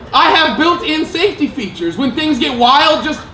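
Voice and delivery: high, mock-cheerful safety pitch; product pitch voice. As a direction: sell it like a ride announcer. Handles speaking like an ad.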